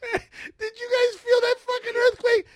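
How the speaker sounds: loud, very high-pitched agitated outburst; worked-up disbelief. Retold or imitated. Shaken man asks the neighbors about the earthquake, agitated. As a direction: plead in squealing disbelief.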